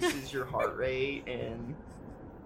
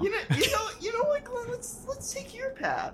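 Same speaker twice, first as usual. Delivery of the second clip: high squeaky voice. Acted.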